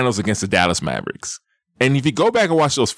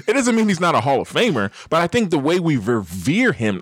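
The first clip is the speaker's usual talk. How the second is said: ordinary talk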